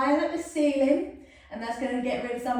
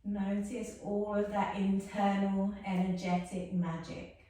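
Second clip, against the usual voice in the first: soft, calm yoga-teacher guidance; gentle cool-down cueing voice; guided relaxation; 4 dB quieter than usual. Acted. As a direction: ease the class into stillness softly.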